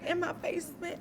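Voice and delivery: high, small, soft voice with a laugh; sheepish retold admission. Admitting to network bosses she faked it, sheepish. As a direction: confess it meekly, half laughing.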